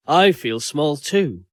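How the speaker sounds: usual speaking voice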